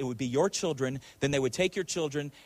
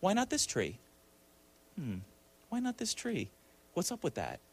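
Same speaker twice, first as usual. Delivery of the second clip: softer, lightly acted sceptical questioning; insinuating doubt; 3 semitones higher, 7 dB quieter than usual. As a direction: drop the volume and plant a little doubt. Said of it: "a little bit of doubt".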